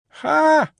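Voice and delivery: sharp high scoffing ha; scornful ha. A narrator's reading. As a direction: spit out a contemptuous ha. Miss Pross scoffs.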